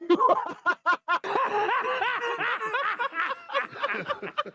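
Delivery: long, high cackling laugh; real laughing fit. Real, not acted. Listener reacting to "stay out of this", helpless high laughter. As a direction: crack up and keep laughing. Cracks up at the remark.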